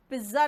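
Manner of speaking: पिज्जा as pizza with only a z, PIZ-za; wrong pronunciation. Shown as a demonstration. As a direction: say पिज्जा with a buzz and no t. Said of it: "पिज्जा नहीं होता".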